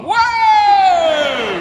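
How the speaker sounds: high, long, loud holler; imitated back-row shout. People at the back react, imitated loud cheer. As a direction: yell it drawn out like a crowd.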